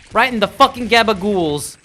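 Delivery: loud, high mobster yell; mob shout. Pretend. Gamer yells at getting hit, loud and high. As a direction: bellow it like a wiseguy.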